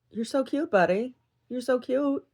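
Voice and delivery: high-pitched cooing to a little buddy. Real, not acted. Doting on a cute buddy, high-pitched cooing. Look at him.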